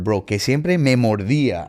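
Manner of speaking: usual speaking voice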